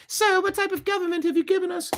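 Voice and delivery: quick, very high British falsetto; squeaky mock British lady; British townswoman. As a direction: put on a silly British woman's voice. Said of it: "Why did she become British".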